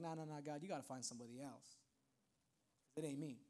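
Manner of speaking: very quiet, low refusal; self-doubting refusal. Acted. Wounded believer turning down God's call, quiet low refusal. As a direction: back out under your breath, voice dropped low.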